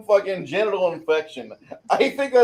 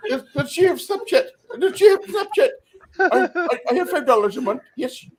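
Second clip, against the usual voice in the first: put-on Indian accent; 8 semitones higher than usual. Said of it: "that Indian voice".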